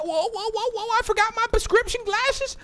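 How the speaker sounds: very high, wailing mock alarm; hammed-up high wail; high-pitched grandpa act. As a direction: cry out in a squeaky panic. Playful outburst as Grandpa Stat.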